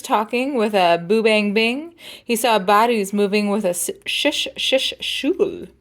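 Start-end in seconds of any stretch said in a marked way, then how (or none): 4.0-5.7 slow, drawn-out shish shoo; brush drum sounds. Shown as a demonstration. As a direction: brush the words like a snare drum.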